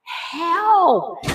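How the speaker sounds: high mock cry, not truly loud; high-pitched retold yell; imitated scream. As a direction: mimic a scream at storytelling volume. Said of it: "she's screaming loud".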